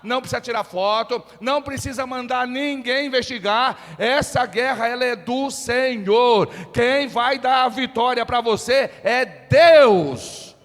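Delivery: high, very loud shouted sermon; full-force preaching. Real, not acted. Preacher urging the congregation to shout along, full-force preaching. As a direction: thunder it out, stretching the key words.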